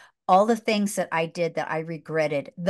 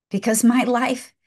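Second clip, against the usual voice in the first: usual speaking voice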